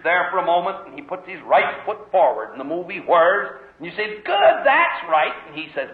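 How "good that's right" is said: slow, much higher, louder praise; cheering parent. Retold or imitated. Parent cheering the baby's first step, slow loud praise. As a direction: call out delighted encouragement to the toddler.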